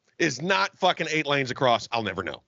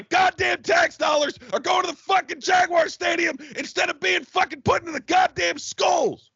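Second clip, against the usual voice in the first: angry shout; 10 semitones higher than usual. Real, not acted. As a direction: vent about the tax money, shouting. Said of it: "Still pissed?".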